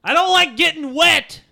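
high, loud protest; high-pitched yelled whine of protest; shouting back. Acted. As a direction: holler your complaint back across the water. Shouting back across the river.

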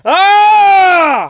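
sudden high yell; shout. Real, not acted. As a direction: let out a loud cry. Sudden yell over audio limit.

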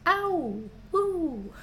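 squeaky high woo; high cheer. Real, not acted. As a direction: cheer them on. Cheering patrons.

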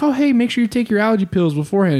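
bright quick coaxing tone; cheerful fake-friendly. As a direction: coax her brightly, fast. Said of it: "he's like".

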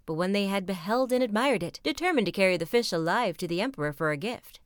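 plain reading voice

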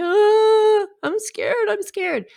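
high frightened child's wail, imitated; scared little kid. Little kid holding a parent's hand at the roller coaster, mock scared. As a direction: mimic a kid panicking before the ride. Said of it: "And yet they're so scared".

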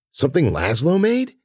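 questioning lift on "made"; incredulous question. A narrator's reading. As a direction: repeat it back in hushed disbelief. Echoes the claim in disbelief.

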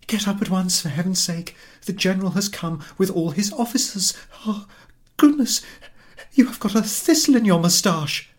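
high, loud flustered urging; wife pressing husband to get up now; urgent wife. Acted. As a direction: hurry him up, fussing.

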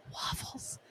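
put-on whispered Waffles, turned away so the child can't hear. Aunt secretly whispering Waffles to a relative, hushed. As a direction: breathe the word Waffles with no voice. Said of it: "so he couldn't see me".

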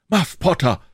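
high, excited answering shout; crowd cry. A narrator's reading. Crowd answers who is coming, high excited cry. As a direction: call the name out, pitched well up.

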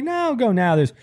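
mock dad, upbeat and brisk; dad insisting. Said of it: "He's like".

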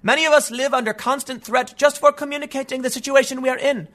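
high, even-pitched mocking read; put-on high activist voice; mock Greta Thunberg. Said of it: "She said".

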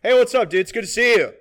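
high, booming friendly greeting; imitating an oblivious loud talker; loud talking voice. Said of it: "What's your talking voice again?".

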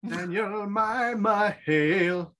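very slow mock-solemn greeting; slow, grave, hammed-up address. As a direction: draw out each word like a grand elder.

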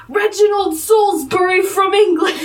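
hammed-up fake English accent through a laugh; bad British accent. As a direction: put on a terrible British accent. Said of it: "his accent is so much worse than Logan's".

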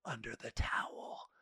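a breathy secret whisper under the voice. Retold or imitated. Masseuse's hushed proposition retold.

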